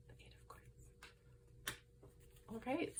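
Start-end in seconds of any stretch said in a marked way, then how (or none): 0.1-0.9 whispered. Real, not acted. Card read softly to herself.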